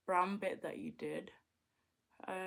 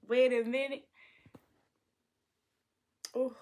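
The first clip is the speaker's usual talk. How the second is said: excited; 4 semitones higher, 9 dB louder than usual. Acted.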